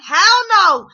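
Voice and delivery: yelling at the TV. Retold or imitated. Viewer answering the lawyer through the TV, high loud Hell no. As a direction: shout it at the screen in agreement. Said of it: "through the T V".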